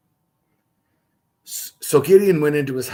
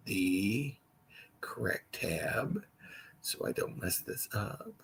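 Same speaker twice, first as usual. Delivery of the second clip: whisper; 3 semitones lower, 12 dB quieter, slower than usual. Real, not acted. Quietly hunting for the tab.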